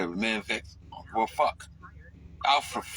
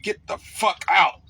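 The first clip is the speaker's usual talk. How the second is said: higher, louder and slow, each word pushed out; "Get the fuck out" shouted high and slow, landing on "out"; loud repeat; 6 semitones higher, 5 dB louder, slower than usual. Retold or imitated. Louder repeat of muttered line.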